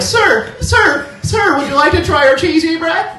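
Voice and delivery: very high, eager upsell voice; pizza phone kid. Retold or imitated. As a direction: chirp it like a teenage phone clerk pushing extras. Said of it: "This kid's on the phone, he's like".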